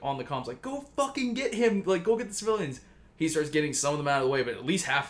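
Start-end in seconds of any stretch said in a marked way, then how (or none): 0.6-2.8 high-pitched imitation of a yelled order; retold yell. Bruce orders Barry over the comms, mock yelling. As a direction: pitch it up urgently without real volume. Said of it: "Bruce starts yelling at Barry".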